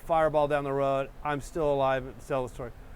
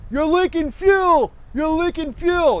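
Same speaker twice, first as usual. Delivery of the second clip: high, loud, slow shouted warning; yelling; 12 semitones higher, 6 dB louder, slower than usual. Retold or imitated. Driver on the freeway shouting a warning, yelling. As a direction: call out across the freeway lanes. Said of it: "some guy was yelling".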